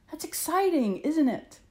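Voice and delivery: high, lifted storyteller excitement; excited. A narrator's reading. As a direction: share the thrill with young listeners. Storyteller shares excitement with listeners.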